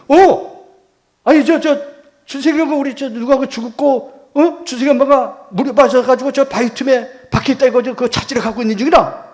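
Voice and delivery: high, loud, urgent reply; agitated explaining. Retold or imitated. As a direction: act out a flustered, insistent explanation. Dazed man's agitated reply, acted out. (다급하고 높은 목소리로 횡설수설 설명하듯)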